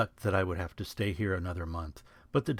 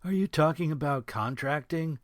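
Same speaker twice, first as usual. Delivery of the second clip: timid; 7 semitones higher, 3 dB louder than usual. A narrator's reading. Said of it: "Thomas said timidly".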